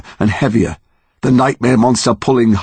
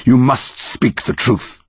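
slow, raised-pitch command; commanding monster; 4 semitones higher, slower than usual. A narrator's reading. Yew tree demanding Connor's truth, slow insistent command. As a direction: press each word on the listener.

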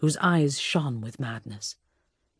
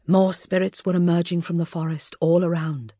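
usual speaking voice

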